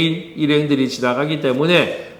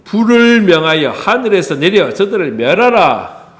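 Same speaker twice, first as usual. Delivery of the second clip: loud, raised, declaimed plea; imitated fiery demand; 5 semitones higher, 6 dB louder than usual. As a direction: proclaim it like hot-headed disciples calling down fire. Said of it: "예수님 앞에 요청하는 것이". (불같은 요청을 크게 외치듯)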